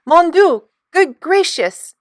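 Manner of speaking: high, loud cries in alarm; panicked exclamation, slowing on good gracious; excited. A narrator's reading. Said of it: "In her excitement".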